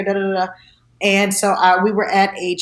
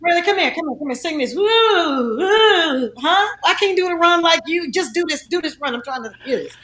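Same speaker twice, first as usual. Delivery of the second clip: sing-song high, coaxing her to sing the run; 9 semitones higher than usual. Retold or imitated.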